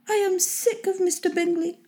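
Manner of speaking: high-pitched, understated cry of vexation; high matronly outburst without volume; vexed wife crying out. A narrator's reading. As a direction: complain as the fed-up wife, pitched well up. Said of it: "cried his wife".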